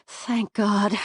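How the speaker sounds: plain reading voice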